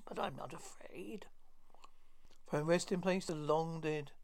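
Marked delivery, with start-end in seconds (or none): 0.0-1.3 whispered, hushed line. Acted. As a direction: breathe it without voice. Hushed aside after summoning.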